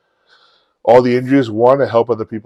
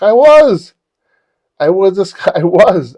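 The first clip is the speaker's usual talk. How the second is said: loud, high, retold outburst; emphatic admission; 9 semitones higher, 9 dB louder, slower than usual. Man admitting his past to friends, emphatic. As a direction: belt out the confession.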